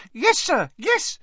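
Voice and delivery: high, loud, eager elf voice; Dobby, eager squeak. A narrator's reading. House-elf eagerly answering Harry, squeaky and loud. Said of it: "said Dobby".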